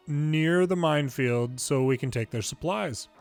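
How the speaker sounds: usual speaking voice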